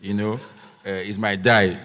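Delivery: usual speaking voice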